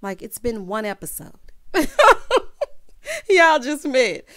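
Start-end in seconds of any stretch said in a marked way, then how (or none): 1.4-3.2 loud, high burst of laughter; big incredulous laugh. Real, not acted. Host laughing at a hasty dating claim, incredulous laugh. 3.3-4.2 loud, high disbelieving exclamation; loud incredulity. Real, not acted. Host mocking two daters' haste, loud disbelief.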